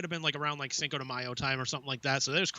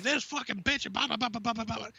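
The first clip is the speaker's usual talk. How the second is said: high-pitched mock tirade; hammed-up cursing that runs into blah-blah; Jim Cornette-esque rant; 7 semitones higher than usual. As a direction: imitate a friend ranting on and on. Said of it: "Jim Cornette-esque rant".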